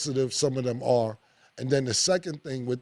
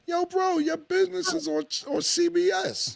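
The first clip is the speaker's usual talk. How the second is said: much higher, pleading exasperation; exasperated outburst. Acted. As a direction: yo bro, it's everywhere — pitch it way up. Exasperated retort to athletes.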